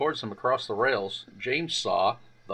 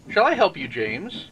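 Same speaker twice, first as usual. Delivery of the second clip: higher, louder friendly offer; higher friendly Edward calling; kindly Edward; 5 semitones higher, 4 dB louder than usual. Acted. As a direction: call out a helping hand. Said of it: "called Edward".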